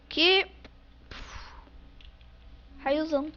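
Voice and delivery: ordinary delivery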